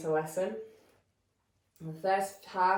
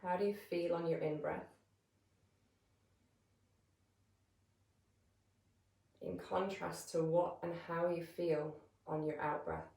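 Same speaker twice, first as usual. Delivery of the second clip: calm, measured meditation voice with long pauses; guided meditation; 7 dB quieter than usual. Real, not acted. Yoga teacher settling listeners into breath awareness, calm guided meditation. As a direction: guide them gently into stillness, leaving space between phrases.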